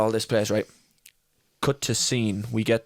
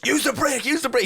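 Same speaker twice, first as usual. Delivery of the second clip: high shouted warning, said twice; retold shouting; 14 semitones higher than usual. Instructor yelling at the learner driver, high and shouted. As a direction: yell it at the learner in alarm. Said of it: "He starts shouting at me".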